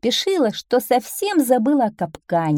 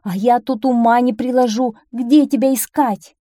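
raised, higher voice of a scolding mother; scolding call; 5 semitones higher, 6 dB louder than usual. A narrator's reading. Old duck who searched for her duckling, loud scolding. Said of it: "издали крикнула ему старая утка".

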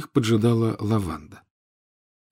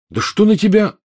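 exasperated outburst; 12 semitones higher, 5 dB louder than usual. Acted. Harry exasperated.

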